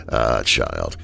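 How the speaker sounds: low, unsettling, knowing drawl; sinister villain. Acted. Villain warning James of the dire situation, low and sinister. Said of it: "He laughed in an unsettling manner".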